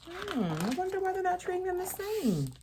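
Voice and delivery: high, soft, musing question; gentle curious teacher voice; wondering aside. Real, not acted. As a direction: wonder aloud to the little listeners. Reader asks children a question.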